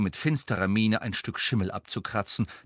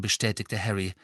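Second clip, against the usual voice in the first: ordinary delivery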